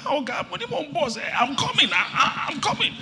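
high-pitched, flustered excuse-maker; comic squeal of a harried caller; flustered young man. Retold or imitated. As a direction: imitate someone caught out and stalling. Acting flustered man on phone.

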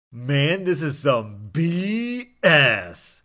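loud, high, drawn-out mock yell. Drunk passenger yelling about the delay, loud slow yell. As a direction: bellow it slowly like a fed-up drunk. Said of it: "Dirk yelled out".

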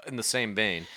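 usual speaking voice

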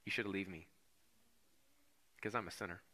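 soft, humble plea of a sinner; a quiet, ashamed admission; hushed confession. Acted. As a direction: confess it quietly, head bowed. Peter's confession voiced softly.

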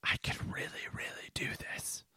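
secret hushed whisper. Retold or imitated. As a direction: breathe it like a secret. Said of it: "because I'm like".

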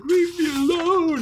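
very high mock wail; hysterical crying. Retelling Jen crying at Vince, mock hysterical. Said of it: "she's crying, all hysterical".

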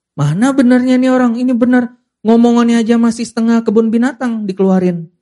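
high, sneering disbelief; scoffing mock doubt. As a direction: scoff at a so-called righteous man. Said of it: "Masalahnya yang kita lihat".

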